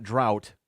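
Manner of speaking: DROUGHT as drowt, rhyming with out; wrong vowel version. Shown as a demonstration. Host ruling out another version, DROUGHT said drowt. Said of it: "It's not drought".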